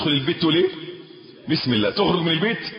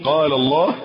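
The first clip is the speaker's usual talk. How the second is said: ordinary talk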